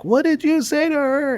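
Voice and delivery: high-pitched imitation of yelling; mock yell. Guest retelling a road-rage lady's outburst, high mock yell. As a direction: mimic an irate yell way up high. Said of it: "is about yelling at me".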